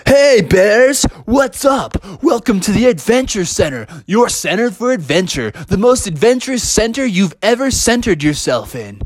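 high, swooping hype-guy greeting; adventure center employee. Acted. Employee greeting the Bear family at the adventure center, hyped-up welcome. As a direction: welcome them like an over-pumped tour guide. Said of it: "you could hear the adventure center employees saying".

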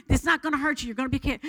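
normal talking voice

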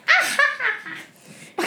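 high cackling witch laugh. Retold or imitated. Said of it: "that's like a witch laugh".

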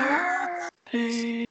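high strained hushed yell; whisper scream. Acted. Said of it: "in the quietest voices we can muster".

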